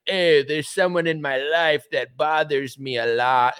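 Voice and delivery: slow, mocking put-on voice; mock passive-aggressive complaint, slowly; passive-aggressive meme. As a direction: drag it out like a passive-aggressive social media post. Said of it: "It's very passive aggressive".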